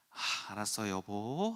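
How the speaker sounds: soft, low, subdued reply; calmed down. Retold or imitated. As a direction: give in to your wife quietly, retold. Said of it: "좀 차분해진 마음으로". (차분해진 목소리로 낮고 부드럽게)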